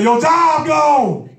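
high, slow, shouted taunt; taunting enemy. Retold or imitated. Preacher voicing the enemy taunting a believer, slow high jeering. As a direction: drag out each loss to mock them. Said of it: "The enemy gonna say".

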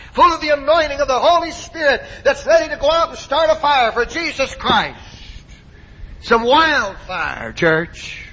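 impassioned pulpit build, climbing high on each phrase; full-force preaching. Real, not acted. Preacher calling the church to start a fire for Christ, full-force preaching. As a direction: pile up the phrases into a rallying cry.

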